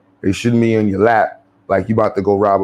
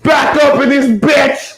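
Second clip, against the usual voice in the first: high, loud, slow panicked shout; 5 dB louder, slower than usual. Retold or imitated.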